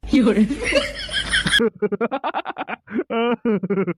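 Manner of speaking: high, hammed-up villain laughter; drawn-out mock-evil laugh. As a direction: cackle like a gang boss amused by violence. Boss laughs at nephew's boast.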